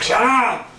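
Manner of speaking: very high mock yell; irritated yell. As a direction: squawk it at your noisy buddy, imitating him. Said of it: "He's like".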